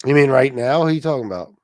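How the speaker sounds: normal talking voice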